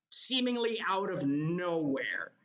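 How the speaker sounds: ordinary talk, nothing marked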